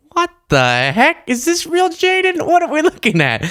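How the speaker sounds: high, disbelieving question; can't believe what's on screen; surprised. Real, not acted. Shocked by Piers Morgan's old tweet.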